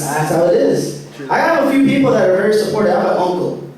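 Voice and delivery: ordinary delivery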